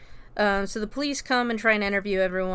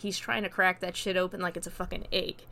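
usual speaking voice

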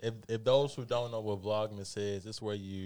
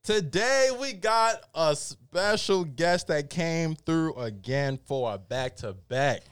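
hype intro; 7 semitones higher, 8 dB louder, slower than usual. Real, not acted. Host hyping intro to show.

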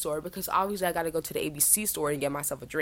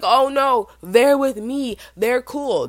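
fake casual; 6 semitones higher, 8 dB louder, much slower than usual. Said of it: "trying to act like it was fine".

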